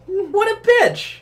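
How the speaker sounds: high, put-on indignant voice; squeaky mock indignation; mock outrage. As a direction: play shocked and outraged. Mock outrage at penny lady.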